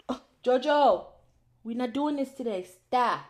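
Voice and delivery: firm call, stop it now; scolding the dog. Real, not acted.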